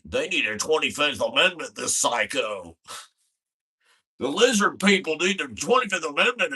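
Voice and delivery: Alex Jones impression. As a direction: rant it like a wild-eyed radio host. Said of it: "fucking Alex Jones ... is like".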